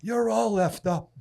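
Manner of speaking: mock gruff scorn. Imitating Nate telling the young man off. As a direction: hand down the verdict with relish. Nate's verdict, retold.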